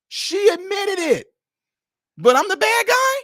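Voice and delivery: real high, loud incredulous protest; indignant outburst. Real, not acted. Host protests being blamed for the woman's choice, indignant. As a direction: push way up high, loud with disbelief.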